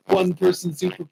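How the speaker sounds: very high, frantic, rushed disbelief; panicked disbelief. Retold or imitated. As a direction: squeal it like you're outclassed. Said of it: "they're like".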